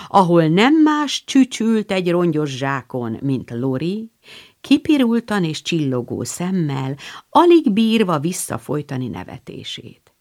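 ordinary delivery

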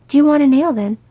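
loud, high, blurted offer. Acted. Beaufort asking Gimlis to have an ale, blurting. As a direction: blurt it out nervously in one burst. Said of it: "Beaufort blurted".

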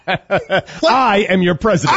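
slow, laughing through the words; slow, laughing imitation of a pompous president; laughing mock quote.

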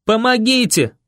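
high desperate cry; yelling for help. A narrator's reading. Boy yells on the run for help, yelling. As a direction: call out across empty streets. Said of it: "на бегу я орал".

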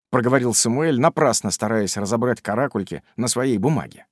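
plain reading voice

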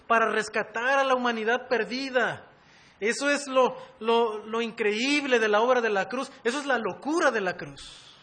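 high, loud sermon at full force; impassioned preaching. Real, not acted. Preacher proclaiming the wonder of the cross, impassioned preaching. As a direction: proclaim it fervently, driving every phrase.

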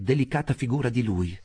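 usual speaking voice

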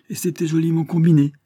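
loud, high, swaggering brag; cocky pride in a clever scheme; cynical boasting. A narrator's reading. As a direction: boast shamelessly with voice lifted. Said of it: "avec une cynique forfanterie".

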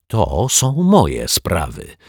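slow, firm and deliberate; insisting, slow and firm; with emphasis. Acted. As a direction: lay weight on every word. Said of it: "powiedział z naciskiem".

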